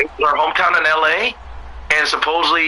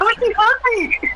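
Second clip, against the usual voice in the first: mock sweet; slower than usual. Teasing friend mimics Melina.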